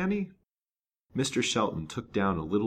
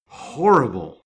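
loud shocked cry, pitched up; horrified exclamation; 7 semitones higher, 14 dB louder, slower than usual. A narrator's reading. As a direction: burst out in horror. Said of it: "exclaimed the detective".

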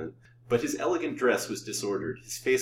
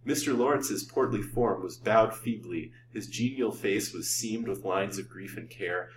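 ordinary delivery

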